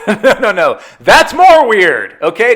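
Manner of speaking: mock-horrified refusal, drawn out; retold loud objection. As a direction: shoot dad's idea down emphatically. Retold objection to keeping secret.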